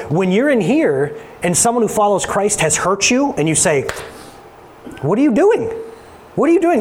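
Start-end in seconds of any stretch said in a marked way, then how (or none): 5.0-6.8 high-pitched exasperated demand; acted reproach, pitched well up; mock indignant confrontation. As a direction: call someone out in disbelief. Acts out confronting someone who hurt you.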